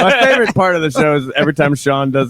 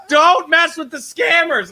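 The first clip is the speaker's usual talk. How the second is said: much higher, slow, shouted warning; 10 semitones higher, slower than usual. Retold or imitated. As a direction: yell it as a dire warning.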